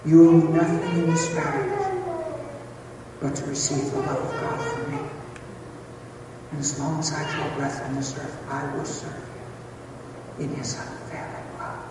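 soft, earnest promise; tender vow. Retold or imitated. Husband renewing vows to wife, soft tender pledge. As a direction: fade to a hushed, solemn pledge.

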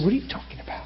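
whispered, puzzled question; soft unvoiced what-are-you-on-about; whispered wife. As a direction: hush it like a confused wife. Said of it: "And she goes, she said".

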